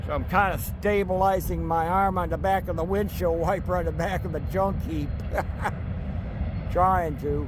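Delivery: speaking up over the rumble of a train. Real, not acted. Filming a loud passing train.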